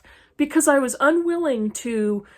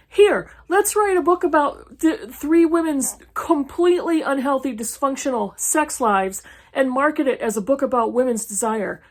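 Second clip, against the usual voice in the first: sarcastic mock pitch; 3 semitones higher than usual. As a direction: parody the author pitching her idea. Mocking the author's book pitch.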